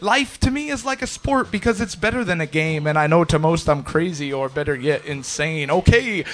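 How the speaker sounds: driving, rhythmic slam poem with punched words; spoken-word poetry. Acted. Poet describing a racing mind, driving spoken-word performance. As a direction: perform it as relentless rhymed verse.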